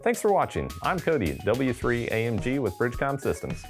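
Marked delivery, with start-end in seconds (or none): none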